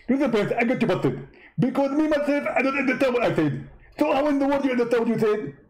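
high-pitched mock debater voice; a squeaky, smug rival preacher; Zakir Naik impression. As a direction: push the voice way up and lampoon him. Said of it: "how you talk like that".

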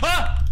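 sudden high scream of fright; startled scream. Real, not acted. Gamer jolted by a ghost, screaming in fright. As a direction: shriek at the jump scare. Jump scare.

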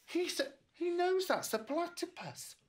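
plain reading voice

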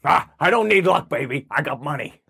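cocky put-on tycoon voice; Jeff Bezos. Billionaire waving away good wishes, cocky caricature voice. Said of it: "Jeff, we're gonna have to tax you".

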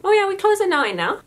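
friendly Newfoundland closing-time line; Newfoundland accent. Retold or imitated. Cashier tells a caller the hours in a Newfoundland accent. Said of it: "in that voice".

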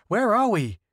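sharp insistent question; demanding. A narrator's reading. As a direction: demand an answer. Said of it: "Scylla demanded".

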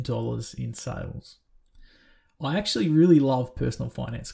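energetic show-opener voice; podcast intro read. Acted. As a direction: sell the guest to the listeners like a radio host. Scripted podcast guest introduction.

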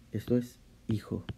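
hushed whisper of a discovery. Real, not acted. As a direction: breathe it out like a secret. Revealing meaning of a word.

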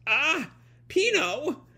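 high, drawn-out cry of dismay; acted gasp, then a stretched high cry; alarmed cry. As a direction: call the name in high-pitched alarm. Alarm as Pino gets hypnotized.

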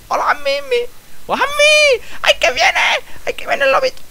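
very high, loud squeaky voice; falsetto squeal. Acted. Player greeting creatures as the little wolf comes, falsetto squeal. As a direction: squeal excitedly in a put-on tiny voice. Cooing at game animals in falsetto.

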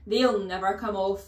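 loud, scornful retort; shouted back. Acted. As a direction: fling the answer back hard. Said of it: "the Trunchbull shouted back".

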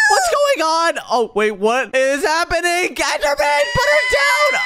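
high, loud panicked yelling; alarmed shout. Acted. Bystander ordering Enderman to put her down, panicked shout. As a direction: yell at the attacker in alarm.

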